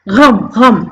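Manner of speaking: गम as "gham", from the throat; guttural gh at the start of गम; nukta pronunciation. Shown as a demonstration. Teacher repeating the dotted word, गम with throaty gh. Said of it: "नहीं बोलना है".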